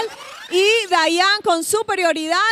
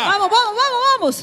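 cheering; 5 semitones higher, much faster than usual. Real, not acted. Cheering at the finish.

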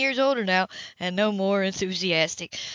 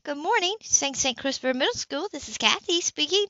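perky phone greeting; 7 semitones higher than usual. A narrator's reading. Receptionist answers phone cheerily.

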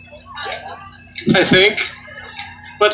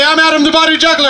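higher, louder showman announcement; showman intro; 8 semitones higher than usual. Acted. Juggler asking the crowd to cheer, showman intro. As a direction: announce yourself big for cheers. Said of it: "This is where you cheer loudly".

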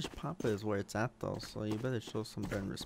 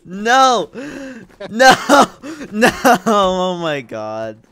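loud high panicked no's; panicked screaming; 10 semitones higher, 21 dB louder, much slower than usual. Real, not acted. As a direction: scream no over and over. Panicked under attack in game.

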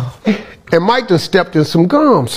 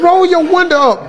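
yelling; 11 semitones higher than usual. Retold or imitated. Yelling at Mike in car.

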